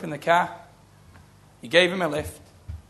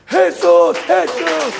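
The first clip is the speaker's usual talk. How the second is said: slow, drawn-out shout, much louder and a little higher; drawn-out joyful shouting of Jesus; retold shout; 3 semitones higher, 10 dB louder, much slower than usual. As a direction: cry the name out long and loud as she did. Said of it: "She was shouting".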